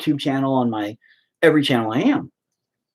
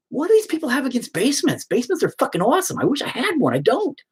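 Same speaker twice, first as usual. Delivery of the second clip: excited rant; 11 semitones higher than usual. Real, not acted. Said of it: "own rant about basements".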